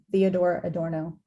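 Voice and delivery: ordinary talk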